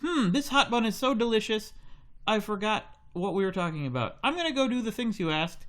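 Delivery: the son won over by a bun, pitched high; kid voice. Acted. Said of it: "he's like".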